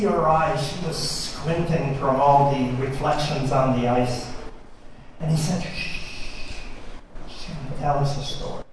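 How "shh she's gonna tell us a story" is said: hushed whisper, quick and soft. Acted. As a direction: shush them in a breathy whisper. Hushing friend under northern lights.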